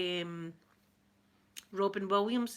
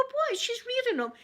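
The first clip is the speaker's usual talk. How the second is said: high, loud mock outrage; high-pitched mock innocence; indignant surprise; 4 dB louder than usual. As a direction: squeak it out as if wrongly accused. Her own indignant retort.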